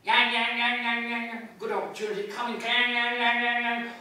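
loud rhythmic yang yang like a tram bell; instrument noise. Acted. Bloom joining the chorus, loud mock instrument sound. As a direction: chant the yangs like an instrument.

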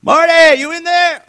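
high, loud call; knocking and calling a friend's name; calling through the door. Retold or imitated. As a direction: call out to someone inside. Caller at the door.

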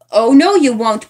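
loud, firm call. Acted. Nancy calls to Mitzi while running up, loud. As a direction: call out as you run up. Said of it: "called Nancy".

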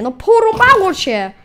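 high, loud, slow telling-off; angry scolding. Real, not acted. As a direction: snap it at the mob, outraged. Scolding the mob.

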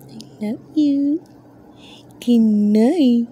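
high, slow cooing I love you. Real, not acted. Mom coos goodnight to her tortoise, high slow baby talk. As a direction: stretch it out sweetly, high.

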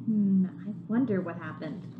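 ordinary delivery

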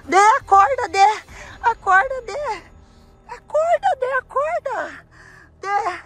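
high-pitched, pleading wake-up calls; urgent calling. Pretend. Girl tries to wake her fallen friend, urgent calling. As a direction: call out to rouse someone unconscious.

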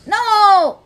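loud, high cry of protest; frustrated shout. Real, not acted. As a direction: shout it out in dismay. Reacts to losing in game.

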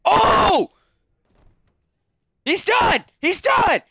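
loud, high-pitched knockout call; Joe Rogan. Acted. Gamer calling his opponent finished, excited commentator shouting. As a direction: shout it like a frenzied fight commentator. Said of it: "my Joe Rogan impression".

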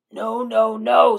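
the little wolves shouting back at the pig; loud refusal. A narrator's reading.

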